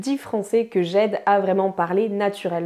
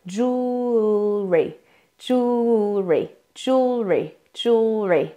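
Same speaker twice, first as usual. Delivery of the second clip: slow, then speeding up; much slower than usual. Shown as a demonstration. Coach drills learners on the word, slow to faster. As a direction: start with a long held joool-ree, quicken each time. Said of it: "de manière lente, et ensuite augmenter le rythme".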